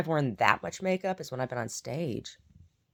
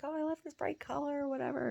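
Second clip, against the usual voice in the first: much higher, gushing, softer; mock excitement; 13 semitones higher, 6 dB quieter than usual. Retelling buying new makeup, mock gushing voice. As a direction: gush over a new lipstick. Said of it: "It's like".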